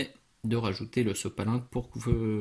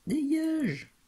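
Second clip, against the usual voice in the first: squeaky high shoo, much higher; exasperated shoo. Real, not acted. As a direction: flick the word off like a pest. Shooing away a stuck hair.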